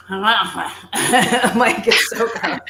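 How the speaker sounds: laughing through mock disgust. Real, not acted. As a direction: giggle the words out.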